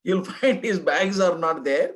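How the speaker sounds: chuckling through the line, slowly; laughing. Real, not acted. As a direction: laugh as you deliver it. Laughing at drunk's fate.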